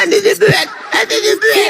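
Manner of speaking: very high, laughing mimicry; mock the opponent's claim in a squeaky-high voice; mocking imitation. Debater parrots his opponent's prophecy claim, mocking high imitation. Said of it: "Seenak perutmu mengatakan".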